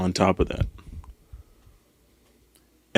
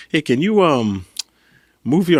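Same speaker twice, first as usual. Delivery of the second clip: put-on a failed Colombian accent, pitched up; Colombian accent attempt; 7 semitones higher, 3 dB louder, slower than usual. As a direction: try a Colombian accent and miss it. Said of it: "I'm trying to replicate his accent".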